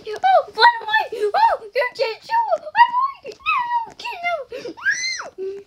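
very high, loud mock wailing; hammed-up distress. Imitating the girl reacting to a fake address, shrieked distress.

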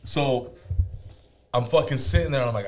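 normal talking voice

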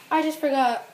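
ordinary talk

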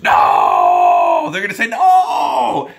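loud, emphatic shout. Real, not acted. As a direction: yell the answer out. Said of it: "exclaiming the answer".

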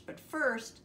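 ordinary delivery, nothing marked

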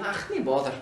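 offhand Scottish "ach, nae bother"; Scottish accent. Acted. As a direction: wave off thanks like a Scot. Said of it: "la personne fait". (accent écossais)

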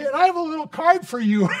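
put-on high, loud, excited child's voice; little girl. Girl handing the elderly person a card, high and excited. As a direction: proudly announce it like a delighted kid.

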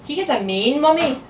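put-on exasperated child, rising loud and high; mildly put-upon little girl; sighing child. A narrator's reading. Daughter correcting her mother about the toy, exasperated sigh. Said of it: "Ailey sighed".

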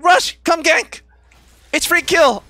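imitating a teammate's eager, high-pitched summons; mock excited call. Streamer mimicking his mid laner begging for a gank, mock excited.

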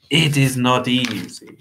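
slow, guarded agreement; cagey. A narrator's reading. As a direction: agree cagily, drawing it out.

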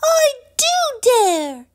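very high, slow, loud defiance; furious defiance. A narrator's reading. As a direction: spit the dare back at the frog. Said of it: "said Poppy".

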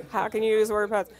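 ordinary talk, nothing marked

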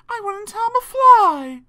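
very high, loud, slow spider; high, drawn-out spider voice; spider nanny protesting. A narrator's reading. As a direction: protest innocence in a high creature voice. Spider reassuring the children.

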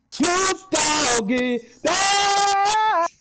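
high, very slow drawn-out shout; ghost shout. Acted. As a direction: stretch each word out like a haunting ghost. Ghost calls out in story.

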